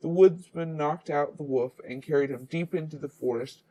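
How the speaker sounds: plain reading voice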